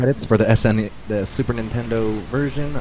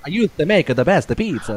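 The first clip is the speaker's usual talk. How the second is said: put-on higher-pitched, hammy Italian accent; sing-song Italian accent, pitched up; 6 semitones higher than usual. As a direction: ham it up as an Italian. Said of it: "Italian accent".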